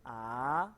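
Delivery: "A" gliding upward; second tone. Shown as a demonstration. Teacher repeating the rising second tone. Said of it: "nada kedua tuh".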